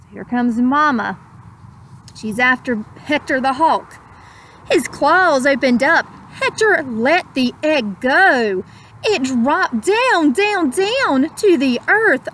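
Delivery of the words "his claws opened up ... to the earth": put-on slow, lilting read-aloud to children; gentle rhyming storyteller; storybook narration. A narrator's reading. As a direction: savour each word like a bedtime story. Rhyming picture-book narration.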